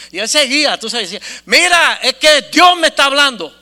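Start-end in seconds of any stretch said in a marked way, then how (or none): 1.5-3.5 loud, high retold outburst; insistent declaration. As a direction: blurt it out to cut him short. Suelta la noticia al socio. (declaración tajante, imitada en voz alta)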